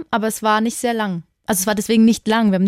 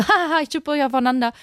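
imitating teasing boy; 6 semitones higher than usual.

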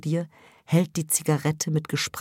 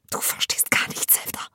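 unvoiced angry whisper; hissed; 3 dB quieter than usual. Mother hisses at Sevda in a whisper. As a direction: hiss it through your teeth. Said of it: "zischst du".

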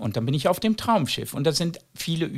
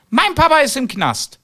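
put-on higher and louder child's blurt; a little boy shouting it out; 12 semitones higher, 7 dB louder than usual. Little boy announcing to the audience, loud and high.

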